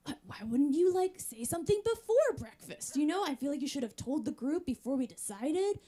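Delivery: mock-outraged questioning; upset. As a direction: retell an upset protest, pitch climbing. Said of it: "you're upset".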